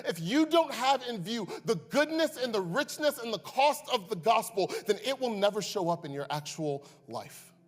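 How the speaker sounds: lightly voiced Paul pressing the church; Paul exhorting. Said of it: "And Paul's going".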